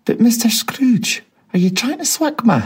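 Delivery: stunned whisper. A narrator's reading. Gentleman stunned.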